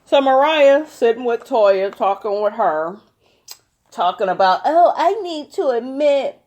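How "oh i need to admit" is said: high, whiny mock quote. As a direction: whine it like a fake victim. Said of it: "no, not".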